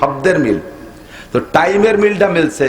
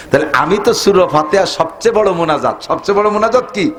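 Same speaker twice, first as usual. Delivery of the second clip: ordinary delivery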